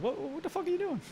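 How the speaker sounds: high, squeaky mock disbelief; mocking friend's voice. Friend baffled at the gunman, squeaky high mock voice. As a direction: pitch way up like a baffled buddy. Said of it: "His own friend was like".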